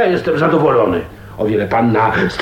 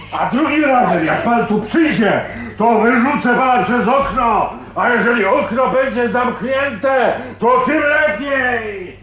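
groźba; 10 semitones higher than usual. Acted. Grozi gościowi wyrzuceniem przez okno.

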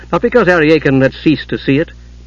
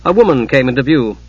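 ordinary delivery

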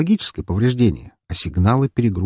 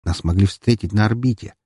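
ordinary delivery, nothing marked

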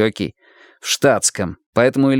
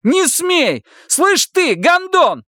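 frantic shout; slower than usual. Acted. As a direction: scream at him to stop. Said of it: "крикнул оглохший Илья".

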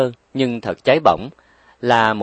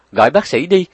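shouting; 6 semitones higher, 5 dB louder than usual. Acted. Panic at hospital bed.

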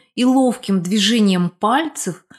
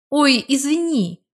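higher, slow cry of sudden remembering; exclaimed apology; 6 semitones higher, slower than usual. A narrator's reading. Man realising he forgot to mention moving, exclaimed apology. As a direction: exclaim the apology as it hits you. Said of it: "воскликнул Беккер".

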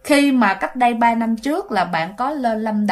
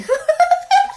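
short shrill ghostly laugh, far higher; eerie high laugh. Retold or imitated. Teller imitating the ghost girl's giggle before leaving. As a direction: give a quick spooky ghost giggle.

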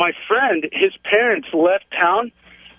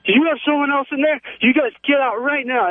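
mock freaking out aunt; 9 semitones higher than usual. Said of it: "She flipped out".

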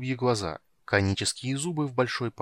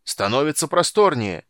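high, loud lookout's call; shouting; 5 semitones higher, 8 dB louder than usual. A narrator's reading. Lookout reports open water to the crew, shouting. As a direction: call it back from the bow. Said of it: "крикнул с носа Фиш".

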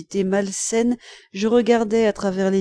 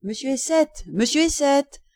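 higher-pitched hail by name; calling out; 6 semitones higher than usual. A narrator's reading. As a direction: call to catch someone passing. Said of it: "j'entendis qu'on appelait".

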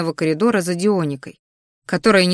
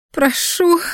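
high howling beg; wailing plea; 10 semitones higher than usual. A narrator's reading. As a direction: wail the word in pain. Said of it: "взвыла Криштия".

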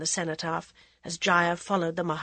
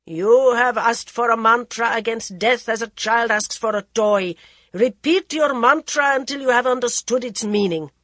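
loud stern reproof; 4 semitones higher, 9 dB louder than usual. Acted. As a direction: cut through her anger with authority. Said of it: "The ascetic's stern voice".

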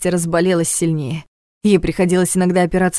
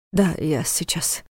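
slow, soft mumble; mumbling; 6 dB quieter, slower than usual. A narrator's reading. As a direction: mumble it, barely holding on. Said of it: "пробормотал Войтах". (тихое бормотание)

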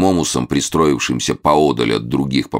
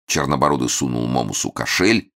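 ordinary delivery, nothing marked

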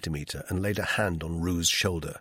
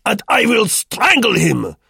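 furious shout; 11 dB louder than usual. A narrator's reading. Roux yells at those holding him, furious. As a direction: struggle free, shouting in rage. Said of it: "Roux shouted furiously".

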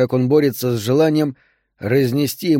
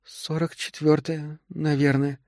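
embarrassed answer; 3 semitones higher, 7 dB quieter, slower than usual. A narrator's reading. Woman in labour tells how far along she is, soft and embarrassed. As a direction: answer shyly, almost under the breath. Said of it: "Та смутилась, но всё же ответила".

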